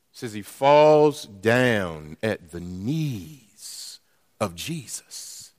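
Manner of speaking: usual speaking voice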